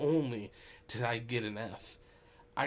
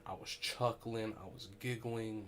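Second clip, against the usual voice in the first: ordinary delivery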